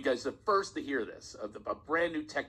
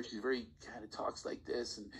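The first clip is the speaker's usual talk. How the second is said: softer, hesitant imitation of a timid client; a hint of a timid caller; tentative client voice; 3 semitones lower, 8 dB quieter than usual. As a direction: lightly mimic her fearful, tentative way of talking. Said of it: "very tentative".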